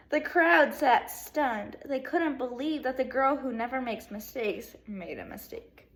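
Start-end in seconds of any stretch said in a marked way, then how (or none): 0.1-1.8 stretched out, stunned; dramatic slow read. Shown as a demonstration. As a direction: let each word land slowly. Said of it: "Let me say that again".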